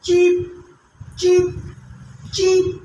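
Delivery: cheap said crisply with a clear ch; cheap, one clean syllable; careful pronunciation. Shown as a demonstration. Teacher modelling the word cheap for students. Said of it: "note the pronunciation".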